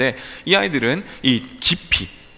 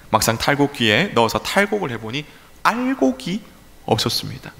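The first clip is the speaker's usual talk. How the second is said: ordinary talk, nothing marked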